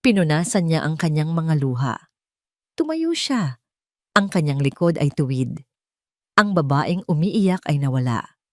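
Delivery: usual speaking voice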